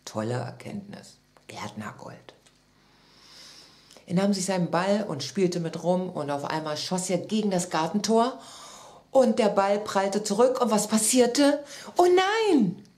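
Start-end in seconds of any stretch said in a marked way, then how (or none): 0.0-2.3 low, soft dejected mumbling to oneself; niedergeschlagen. A narrator's reading. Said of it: "Mit hängendem Kopf". 12.0-12.9 high, loud cry of dismay; alarmed. A narrator's reading. Narrator reacts to ball mishap.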